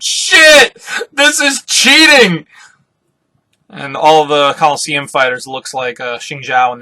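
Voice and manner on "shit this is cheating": high, loud outraged shout; mock-outraged yell, last word drawn out; flipping out. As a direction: shriek it as a hammed-up freak-out. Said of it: "Bartolomeo is flipping out. He's like".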